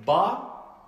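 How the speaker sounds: BA with a full, rounded, dark A; heavy Arabic tafkhim on BA; emphatic, heavy. Shown as a demonstration. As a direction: say BA with the mouth filled out. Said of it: "ce qu'on appelle l'emphase en arabe".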